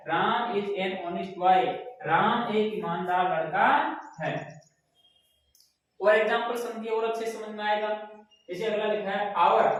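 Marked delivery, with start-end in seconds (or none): 0.1-4.3 put-on very slow, every word of Ram is an honest boy spaced out; slow teacher read-aloud of the example sentence; slow dictation. Shown as a demonstration. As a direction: dictate it slowly for the class. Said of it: "ठीक है".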